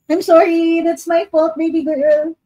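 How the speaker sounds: high cooing apology to the pet; pet talk. Real, not acted.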